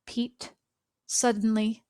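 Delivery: ordinary delivery, nothing marked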